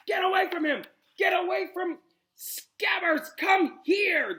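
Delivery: loud, high shout. Acted. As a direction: yell at the cat. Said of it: "his shouts at Crookshanks".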